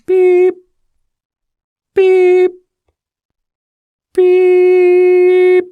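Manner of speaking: long, high electronic beeps; imitated keypad beeps. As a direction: hold each high beep deliberately.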